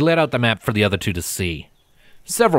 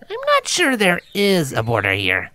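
lightly voiced higher Gilda, musing; 9 semitones higher than usual. A narrator's reading. Gilda muses to the group about the border. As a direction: muse aloud, half to herself. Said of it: "Gilda mused".

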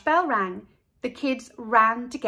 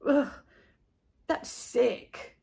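grossed-out groan; mild ugh; scrunched face; 2 semitones higher, much slower than usual. A narrator's reading. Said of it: "scrunching his face".

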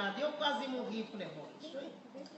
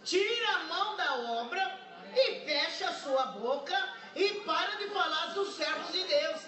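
raised, fervent testimony voice; fervent preaching; 5 semitones higher than usual. Acted. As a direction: preach it out to the congregation.

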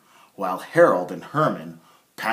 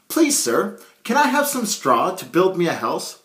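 polite little pig; 9 semitones higher, 4 dB louder than usual. Acted. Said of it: "came upon a man and asked".

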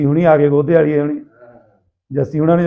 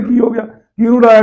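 raised, urgent calling out; louder, higher excited questions; hearty loud trucker banter; 6 semitones higher, 4 dB louder than usual. Retold or imitated. Friends panicking at police raid.

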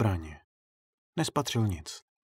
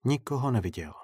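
ordinary delivery

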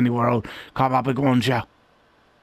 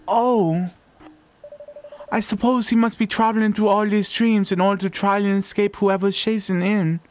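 ordinary delivery

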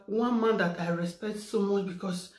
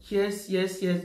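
normal talking voice

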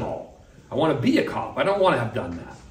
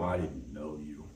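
much lower, softer, slow and grave; solemn rejection; 8 semitones lower, 13 dB quieter than usual. Acted. The Lord turning away the false follower, grave and hushed. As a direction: let it fall like a final verdict. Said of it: "And the Lord's gonna go".

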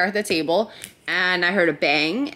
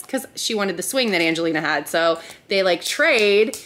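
ordinary talk

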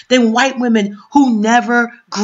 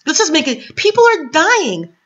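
ordinary delivery

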